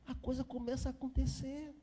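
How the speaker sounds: ordinary talk, nothing marked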